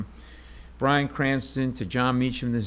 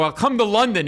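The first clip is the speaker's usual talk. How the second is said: panicked blurt; 10 semitones higher, 5 dB louder than usual. Retold or imitated.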